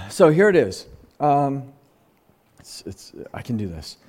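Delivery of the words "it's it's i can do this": choked up. Real, not acted. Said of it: "that's why it's emotional".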